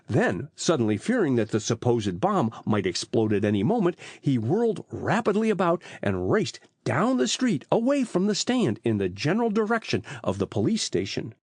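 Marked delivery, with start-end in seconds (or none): none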